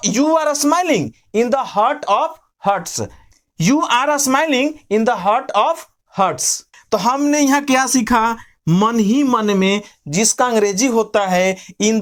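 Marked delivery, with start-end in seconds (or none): 0.1-6.6 slow, deliberate full sentence; slow dictation. Shown as a demonstration. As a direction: read it slowly for learners. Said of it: "ठीक है, तो". (धीरे-धीरे पूरा वाक्य)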